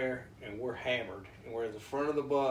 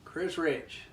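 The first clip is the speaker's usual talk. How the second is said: higher and louder, falling on Rich; announcing the name; 6 semitones higher, 4 dB louder than usual. Real, not acted. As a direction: call it out like an emcee. Second, announcing call of the name.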